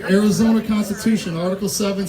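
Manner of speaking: ordinary delivery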